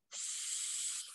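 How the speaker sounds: whispered hissing s held long; drawn-out s like a snake. Shown as a demonstration. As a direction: hiss it like a snake. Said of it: "S like a snake".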